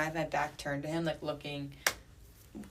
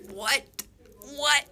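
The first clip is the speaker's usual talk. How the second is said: much higher, loud repeated what; shocked; 10 semitones higher, 4 dB louder than usual. Real, not acted. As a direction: double take in disbelief. Shock at his confession.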